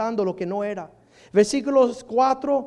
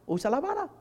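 softer take; 2 semitones higher, 7 dB quieter, faster than usual. Shown as a demonstration.

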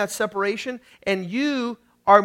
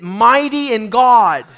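louder, drawn-out declaration; like you mean it; 6 dB louder, slower than usual. Shown as a demonstration. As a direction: proclaim it slowly and with force. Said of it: "say it like you mean it".

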